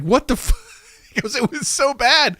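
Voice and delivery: high-pitched exasperated complaint; retold disbelief. Tom reacting to bad movie accents, exasperated disbelief.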